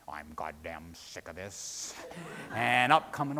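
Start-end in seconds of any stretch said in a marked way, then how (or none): 0.1-1.9 very low, hushed rumble; thundery, rumbly voice. A narrator's reading. Sea's old man rising behind Charlie, thundery rumble. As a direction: rumble it deep and quiet. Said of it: "a thundery, rumbly voice remarked".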